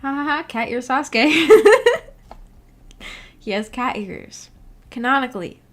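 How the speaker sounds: ordinary delivery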